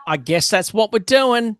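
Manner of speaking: mock meek agreement; compliant. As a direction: give in with a high-pitched shrug. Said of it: "I was so compliant".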